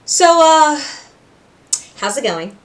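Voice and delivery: high, loud nervous stammer; flustered greeting. Retold or imitated. Pentious greeting Cherry, high and flustered. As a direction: stumble over your words, flustered. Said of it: "he's like".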